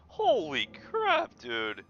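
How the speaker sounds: very high, loud, slow shriek; shocked. Real, not acted. Streamer seeing the creepy photo, shocked shriek. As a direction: gasp it out in shock.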